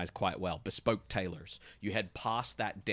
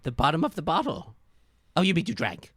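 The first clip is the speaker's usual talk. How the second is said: high, loud flustered detective; 9 semitones higher, 9 dB louder, faster than usual. Acted. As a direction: react awkwardly to the confession. Detective reacts to drinking.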